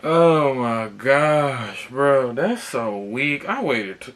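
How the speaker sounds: loud, high, very drawn-out exasperation; real frustrated outburst. Real, not acted. Customer let down after waiting, pissed off and loud. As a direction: cry out in angry disbelief, stretching the words. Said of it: "I'm pretty pissed off".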